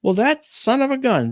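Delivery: high, loud indignant voice; mother's high indignant outburst; exasperated exclamation. Retold or imitated. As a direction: blurt it out indignantly. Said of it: "She goes".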